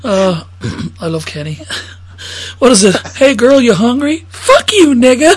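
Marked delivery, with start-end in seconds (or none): 3.2-5.3 loud, much higher angry retort; loud quoted curse. Retold or imitated. Woman cursing a man off, loud high retort. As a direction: snap back loud and high. Quoting a film exchange.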